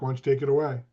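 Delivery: ordinary talk, nothing marked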